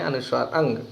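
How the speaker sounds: ordinary talk, nothing marked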